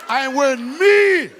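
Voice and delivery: put-on loud, slow, proud African accent; mock African accent. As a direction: declare it boomingly in African accent. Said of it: "That's a funny accent".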